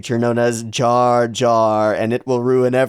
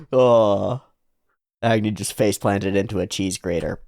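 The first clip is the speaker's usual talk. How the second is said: normal talking voice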